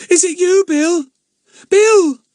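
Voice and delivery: very high, loud eager calling; excited cry. A narrator's reading. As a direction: call out to him, bursting with hope. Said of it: "she cried".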